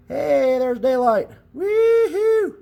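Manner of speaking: high, loud cheer of delight; excited whoop. Real, not acted. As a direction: whoop it up when the light shows through. Finally sees daylight through it.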